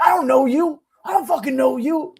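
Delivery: high-pitched indignant yell; yelling neighbor. Retold or imitated. Neighbor rebuffs the new girlfriend, high mock yelling. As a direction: imitate a worked-up neighbor yelling. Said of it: "he wouldn't stop yelling".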